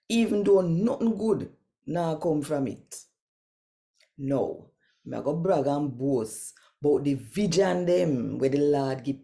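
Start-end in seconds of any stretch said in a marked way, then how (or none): none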